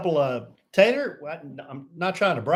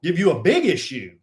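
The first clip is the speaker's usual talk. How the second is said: louder, higher comeback; bickering threat; 4 semitones higher, 5 dB louder than usual. Real, not acted. Host arguing with co-host over audio, bickering threat. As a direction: push back hard, leaning into the threat.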